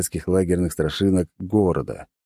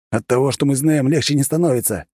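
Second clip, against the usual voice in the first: furious shout; 7 semitones higher than usual. Acted. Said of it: "яростно выкрикнул".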